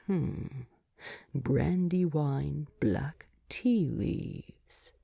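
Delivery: slow, hushed, thoughtful murmur; soft musing whisper. A narrator's reading. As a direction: savour the words in a whisper. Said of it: "eases as he deliberates".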